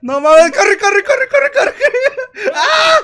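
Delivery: panicked screaming and laughing; being chased. Real, not acted. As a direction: yell in terrified laughter. Monster chasing him in game.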